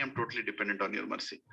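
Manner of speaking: hushed, low retelling of a plea; humble tearful plea, retold. Disciple begging the saint for mercy outside his house, quietly humble. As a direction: say it softly and low, as a humble petition. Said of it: "sitting in front of his house and crying".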